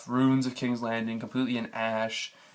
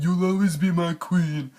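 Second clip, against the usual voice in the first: mocking Jon; 6 semitones higher, 6 dB louder, slower than usual. Mocking Jon before stabbing.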